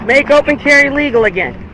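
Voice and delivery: normal talking voice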